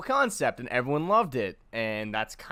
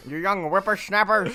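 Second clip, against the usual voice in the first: put-on old man voice; 8 semitones higher, 6 dB louder than usual. Continues the old man bit.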